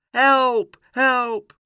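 high, loud cries for help; shouted alarm. A narrator's reading. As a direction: screech for help like a gull. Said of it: "schreeuwde Karel". (luid en hoog om hulp schreeuwen)

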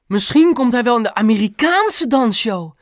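high, loud, thrilled outburst; mother exclaiming a big dream; calling out. A narrator's reading. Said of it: "riep Mam". (opgetogen uitroepen)

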